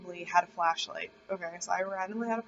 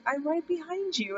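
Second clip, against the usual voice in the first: doll's voice; 7 semitones higher than usual. Retold or imitated. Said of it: "her normal voice came back".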